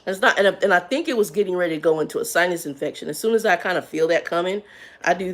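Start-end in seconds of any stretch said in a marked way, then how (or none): none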